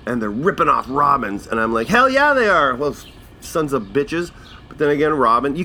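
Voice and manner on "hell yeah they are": emphatic shout. Retold or imitated. Agreeing emphatically, I'm like.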